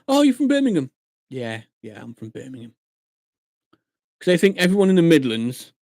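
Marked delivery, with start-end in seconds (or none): 0.1-0.9 high, loud mock realisation; imitated clueless questioner. Stranger learning where someone is from, mock realisation. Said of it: "that's like people going". 1.3-2.7 low, soft giving-in; acted resignation. Local giving up explaining Telford, resigned.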